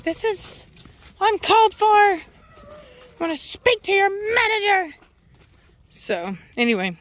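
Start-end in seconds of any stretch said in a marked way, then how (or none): 0.0-5.0 mocking a Karen voicing the dog. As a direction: whine it like a customer demanding the manager. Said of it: "Karen has had enough".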